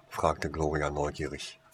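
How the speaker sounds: ordinary delivery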